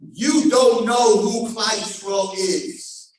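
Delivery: slow, loud declaration; thundering defence. Acted. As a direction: proclaim it with full force. God answers the angels.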